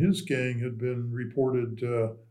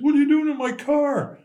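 much higher, louder mock yell; retold angry shout; 14 semitones higher, 5 dB louder than usual. Dad confronting the man in his car, higher and louder retold yell. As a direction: act out dad hauling the guy from the car, yelling.

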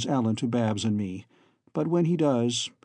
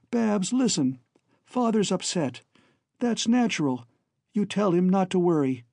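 high, insistent, reassuring brother; earnest young Alan; 5 semitones higher than usual. Acted. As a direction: urge your sister to understand. Voices Alan reassuring his sister.

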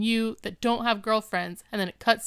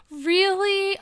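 high, loud excited "Really?"; giddy girlfriend voice; excited squeal; 11 semitones higher, 4 dB louder than usual. Retold or imitated. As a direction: gasp then squeal with joy. She gasps, gets really excited.

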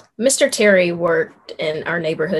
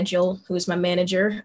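ordinary talk

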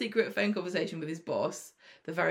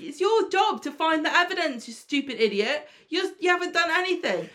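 higher, louder exasperated rant; mock angry telling-off; 9 semitones higher, 4 dB louder than usual. Boss telling off Spider for finding nothing, hammed-up angry and high. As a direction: scold him like a useless employee. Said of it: "I was really mad with Spider".

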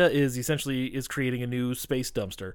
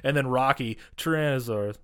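usual speaking voice

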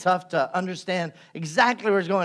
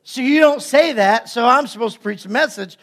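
normal talking voice

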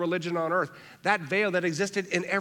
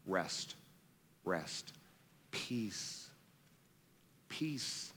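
hushed offering; 9 semitones lower, 13 dB quieter, much slower than usual. Acted. Preacher voicing Jesus offering rest to weary listeners, very soft and hushed. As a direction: drop to a near-whisper, letting each word fall gently.

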